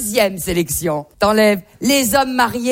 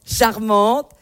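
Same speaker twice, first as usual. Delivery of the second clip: ordinary delivery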